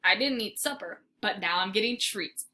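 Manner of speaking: ordinary delivery, nothing marked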